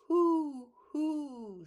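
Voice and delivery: high loud hooting owl. Acted. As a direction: hoot like a fat old owl. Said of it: "said the fat old owl".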